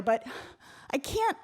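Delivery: ordinary delivery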